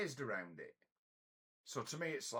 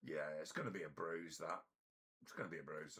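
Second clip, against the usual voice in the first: calm understatement; 7 semitones lower, 7 dB quieter, faster than usual. Retold or imitated. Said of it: "I'm like".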